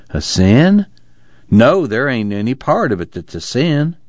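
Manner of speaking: high, incredulous rising question, then denial; astonished. A narrator's reading. Casey turns on John's question about sin, astonished. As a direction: throw it back in sheer disbelief, pitch up. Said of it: "turned on him in astonishment".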